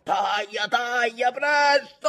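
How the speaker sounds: high cries building to a long yell; urgent shouting. A narrator's reading. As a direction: urge him on desperately. Urgent order in storm. (grido incalzante che cresce)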